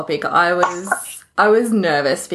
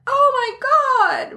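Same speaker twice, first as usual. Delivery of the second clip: shrieked high and a bit louder, mock shocked; mock horror; 3 dB louder than usual. Podcaster imitating people hearing she doesn't edit, mock horrified. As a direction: play up the horrified recoil. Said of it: "recoiling in horror".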